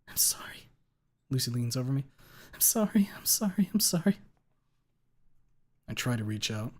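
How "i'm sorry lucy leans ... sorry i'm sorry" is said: whispered, pleading sorry; tearful apologies. A narrator's reading. Lucy crying sorry over the dying narrator. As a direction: sob out sorry.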